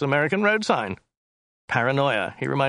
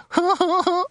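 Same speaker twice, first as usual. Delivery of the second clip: high, brief character guffaw; high mocking laugh; 4 dB louder than usual. Driver laughing at his gun joke, high brash laugh.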